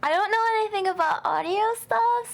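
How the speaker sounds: usual speaking voice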